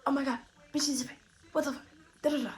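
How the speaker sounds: mock fretful chatter; mock complaining. Acting out her friend's complaining.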